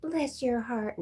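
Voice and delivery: teasing put-on Southern accent; Southern church lady. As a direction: tease in a high Southern drawl. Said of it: "she had a real Southern accent".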